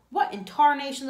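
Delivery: loud, high astonished exclamation; a rancher's loud startled outburst; exclaiming. A narrator's reading. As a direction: blurt it out in loud disbelief. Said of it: "the tall man exclaimed".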